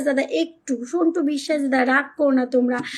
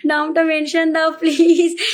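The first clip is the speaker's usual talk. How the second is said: slow, stretched pleading please; drawn-out plea; 4 dB louder, slower than usual. Real, not acted. Begging the viewers slowly to mention their names. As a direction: drag each word out, begging.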